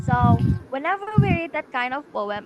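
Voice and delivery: normal talking voice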